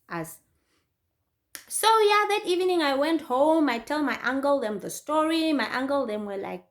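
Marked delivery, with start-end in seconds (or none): none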